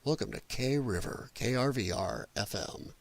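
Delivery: mock sleepy radio announcer. Streamer imitating old local station ID, low and hushed. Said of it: "That's what they sound like these people are from".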